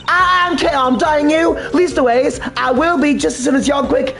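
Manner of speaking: put-on Lana's southern accent. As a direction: snap back in a high Southern accent like a cornered girl. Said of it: "I'm doing Lana's slightly differently".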